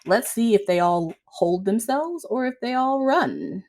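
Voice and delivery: ordinary delivery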